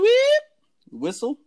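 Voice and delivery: high two-tone beep whistle, drawn out slowly; imitated whistle. Imitating the whistle of the group that jumped him. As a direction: whistle it like the guys calling to you. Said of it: "if you haven't heard a beep".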